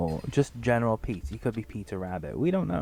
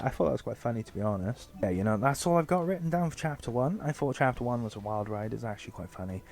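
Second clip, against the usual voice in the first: ordinary delivery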